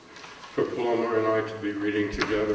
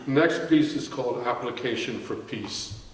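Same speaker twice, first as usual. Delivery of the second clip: ordinary delivery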